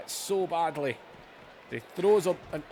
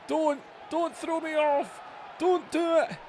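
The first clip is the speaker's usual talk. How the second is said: mock begging; 8 semitones higher than usual. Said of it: "He's begging".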